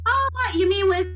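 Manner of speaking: high, playful mock modesty; coy flexing bravado. Strong woman teasing William about her guns, coy and playful. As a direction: show off the muscles with a coy grin.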